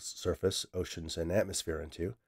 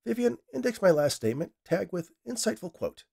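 much higher, a pleased order to the machine; pleased command; 10 semitones higher than usual. Acted. Scientist orders the AI to save his quote, pleased and higher. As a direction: savour your own cleverness.

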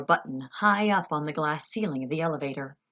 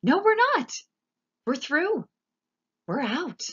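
very high, loud elation; crowing with delight; triumphant cry; 11 semitones higher, 5 dB louder than usual. A narrator's reading. Wonka triumphs through roof.

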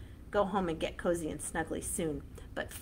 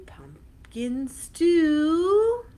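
loud slow call; 8 semitones higher, 9 dB louder, much slower than usual. Shown as a demonstration. Chant, loud ending.

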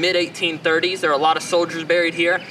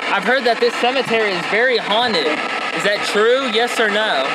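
calling out to spirits; 4 semitones higher than usual. Acted. Investigator addressing spirits at the cemetery, a little raised. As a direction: call out to anyone listening.

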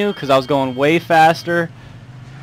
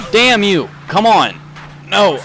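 sharp angry shouts at the screen; angry yelling; 6 semitones higher, slower than usual. Real, not acted. Streamer yelling at the game, angry shouts. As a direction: yell at the game in frustration.

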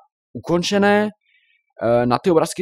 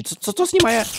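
baffled; 11 semitones higher than usual. Real, not acted. Baffled by dancing cows.

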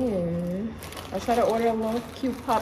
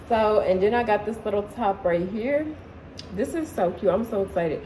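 usual speaking voice

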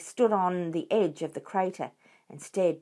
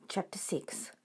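unvoiced whispered heading; 3 semitones lower, 11 dB quieter than usual. A narrator's reading. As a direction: whisper the chapter title.

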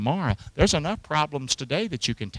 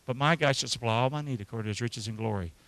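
soft, calm, soothing read; relaxing; 4 semitones lower, 7 dB quieter than usual. Acted. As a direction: ease them down after the frantic verse. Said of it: "Isn't that just sort of more relaxing?".

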